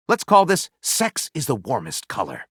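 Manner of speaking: usual speaking voice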